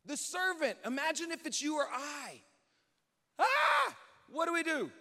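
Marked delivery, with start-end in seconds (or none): 3.4-4.0 high, loud yelp of terror; hammed-up shriek of alarm; panicked scream. Servant sees the surrounding army. 4.3-4.9 loud, raised, alarmed cry; frantic plea for direction; panicked servant. Acted.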